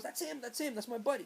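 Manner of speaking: pointing out his buddy, thrilled; excited friend. Retold or imitated. Friend Dwayne spotting the priest, excitedly high. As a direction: voice jumping high and eager. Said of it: "Dwayne goes".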